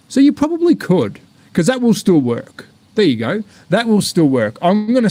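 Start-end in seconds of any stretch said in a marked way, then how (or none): 0.1-1.2 sudden realisation. Real, not acted.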